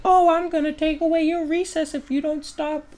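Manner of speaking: high mock teacher voice drawing out the names. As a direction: whine it like a nagging teacher. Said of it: "the teachers would be like".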